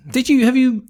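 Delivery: high, probing interviewer. Retold or imitated. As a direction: grill them like a TV interviewer. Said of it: "I'm like that guy on the interviews".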